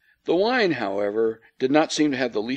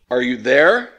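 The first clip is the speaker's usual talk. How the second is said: called louder into the telephone receiver; a raised call into the phone; 6 dB louder than usual. A narrator's reading. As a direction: call down the line to someone unseen. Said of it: "I called".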